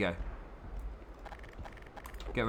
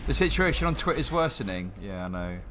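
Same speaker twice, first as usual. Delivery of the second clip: usual speaking voice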